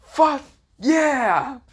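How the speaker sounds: high, loud celebratory shout; triumphant scream. Acted. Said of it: "this is where someone screams out".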